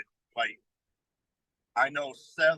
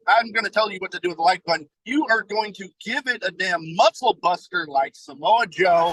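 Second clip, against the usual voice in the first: hyped promo; 4 semitones higher, 5 dB louder than usual. Acted. Wrestling promo hype.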